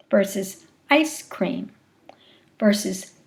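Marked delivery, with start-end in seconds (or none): 0.9-1.7 ICE CREAM slowly in two beats; syllable number demo. Shown as a demonstration. Lecturer showing syllable number, "ice cream" in two slow beats. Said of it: "you can hear syllable number".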